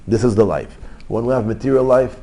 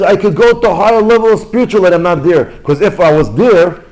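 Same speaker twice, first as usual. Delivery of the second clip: retold lament, much louder and higher; anguished outcry; 8 semitones higher, 8 dB louder than usual. Rabbi weeping over the higher level he missed, loud lament. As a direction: cry out the rabbi's spiritual regret at full volume. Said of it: "Look at what he's crying".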